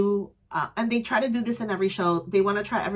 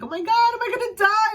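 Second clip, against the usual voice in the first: high, loud panicked squeal; a half-laughing scared cry, higher and louder; mock panic; 13 semitones higher, 5 dB louder than usual. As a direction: play up the fright of being crushed. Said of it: "I did get a little scared".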